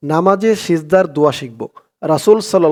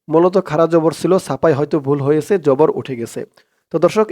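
ordinary talk

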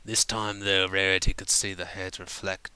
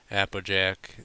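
plain reading voice

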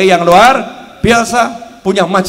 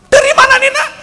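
much higher, amazed mother asking her son; surprised mother; 6 dB louder, faster than usual. Acted. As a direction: gasp it out as the astonished mother. (ibu heran bertanya)

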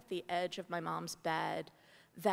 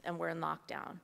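ordinary delivery

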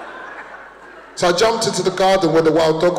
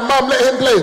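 high, louder plea; pleading child; 7 semitones higher, 3 dB louder than usual. Retold or imitated. Boy begging his mum to let the kid play, pleading. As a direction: beg like a kid. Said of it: "I'm like".

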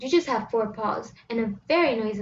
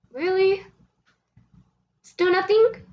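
mock disbelief; 7 semitones higher, 5 dB louder, slower than usual. Exasperated at silent cat.

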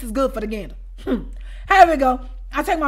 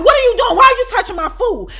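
high loud demanding yell; 9 semitones higher, 7 dB louder than usual. Retold or imitated. Confronting her over the food, high loud yell. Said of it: "So I get to yelling".

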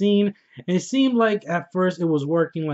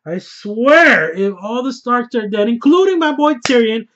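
louder and higher, getting fired up; rising to a near shout over the Starks; worked-up outburst; 6 semitones higher, 7 dB louder than usual. Real, not acted. Viewer dreading the Starks' deaths, louder and higher.

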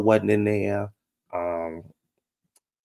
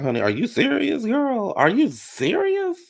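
incredulous squeal. Retold or imitated. Mocking a castmate's claim with a very high squeal. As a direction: shriek it in shocked disbelief. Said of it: "I was like".

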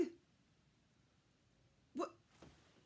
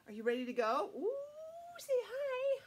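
ordinary delivery

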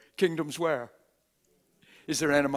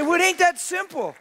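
loud, high whiny objection from the crowd; imitating a protesting churchgoer, hammed up; mock objector; 11 dB louder than usual. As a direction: ham up the doubter's complaint, loud and high. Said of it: "You say".

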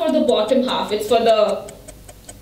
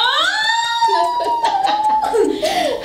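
high shrieking laugh. Real, not acted. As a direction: burst of high squealing laughter. Laughing protest.

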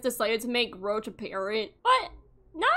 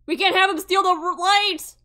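higher, louder urgent call; 6 semitones higher, 6 dB louder than usual. Real, not acted. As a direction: hurry the sim along. Urging sim to stop thief.

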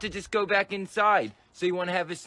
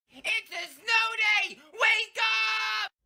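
put-on high, slow shouted kid voice; excited kid shout; 14 semitones higher, much slower than usual. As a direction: shout it to wake your brother. Yelling to wake brother.

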